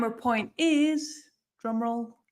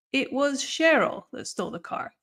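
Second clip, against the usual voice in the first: emphasize the name "Cheryl"; stress on Cheryl; 4 semitones higher than usual. Shown as a demonstration. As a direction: punch "Cheryl", then drop for the rest. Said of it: "Here I'm emphasizing it was Cheryl".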